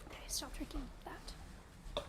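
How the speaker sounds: slow hushed whisper. Real, not acted. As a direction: whisper it off mic. Unvoiced whisper.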